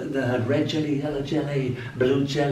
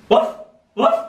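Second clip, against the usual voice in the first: high yappy mock barking; comic woof woof; dog bark imitation; 4 dB louder than usual. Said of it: "said the dog".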